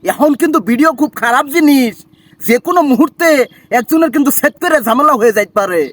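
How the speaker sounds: wary Barishal accent, warning someone; put-on Barishal speech; Barishal dialect. Shown as a demonstration. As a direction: challenge them in broad Barishal dialect. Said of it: "আপনি বরিশালের ভাষা শোনান".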